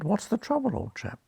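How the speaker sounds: high-swooping Oxford English, falling at the end; lightly mocked Oxford doctor, starting high; perfect Oxford accent. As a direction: put on a polite Oxford lilt. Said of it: "in my perfect Oxford accent".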